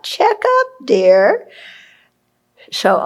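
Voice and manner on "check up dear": put-on high, light voice of the old teacher; Lama's reminder. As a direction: echo his fond little prompt, raised high. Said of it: "what Lama used to say a lot".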